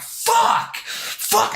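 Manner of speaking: high, strained mock scream; mad cursing. Gamer cursing after getting killed, strained mad scream. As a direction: reenact furious swearing with the volume held back. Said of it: "I'm so fucking mad".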